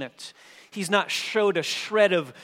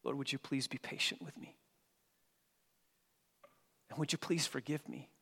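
soft plea; 2 semitones lower, 10 dB quieter, faster than usual. Retold or imitated. Preacher voicing a prayer for mercy.

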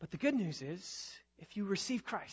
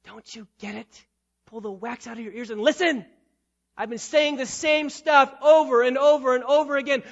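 loud exasperated scolding; fed up, make them listen; frustrated; 4 semitones higher, 11 dB louder than usual. Acted. Jesus scolding deaf listeners, loud exasperated frustration. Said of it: "getting a little frustrated".

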